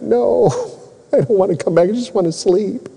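high-pitched mock whine; hammed-up pleading refusal; whiny protest. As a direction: protest like a worn-out man begging for bed. Acting out his unspoken exhausted protest.

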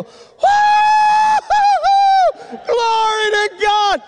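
very high, loud, drawn-out holler; imitated joyful whooping. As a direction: whoop like an overjoyed daddy. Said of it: "And you hear Daddy go".

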